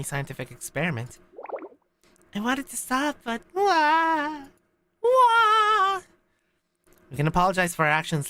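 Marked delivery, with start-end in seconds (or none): 2.3-4.5 very high put-on wail; wailing character voice. Voices Aris wailing in story. 5.0-6.0 very high, loud drawn-out wah; mock wail. Acted wail of the character.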